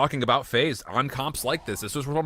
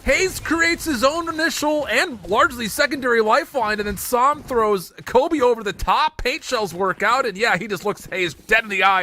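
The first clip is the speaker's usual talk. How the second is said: high, loud, hyped esports call; excited shouting; 10 semitones higher, 6 dB louder than usual. Real, not acted. Caster amazed by Hayes's lifeline play, excited shouting. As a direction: shout it in amazement, pitch soaring.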